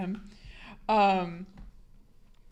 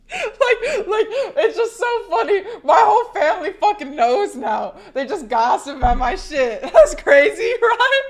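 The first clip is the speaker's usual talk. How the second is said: high, loud laughter through the words; laughing hard; 10 semitones higher, 10 dB louder than usual. Real, not acted. Streamer laughing that her whole family knows, helpless laughter. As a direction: crack up while talking, voice shooting up. Cracking up over family gossiping.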